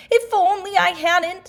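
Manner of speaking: high, loud flustered yelp; loud, high breathless complaint; falling cry. Acted. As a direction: call out mid-tumble. Pooh bouncing down branches.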